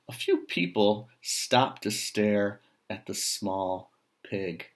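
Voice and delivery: usual speaking voice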